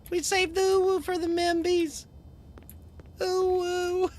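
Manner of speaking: very high put-on uwu voice; cutesy squeak. Streamer teasing chat about uwu for the membies, cutesy squeak. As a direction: squeak it in a cute falsetto.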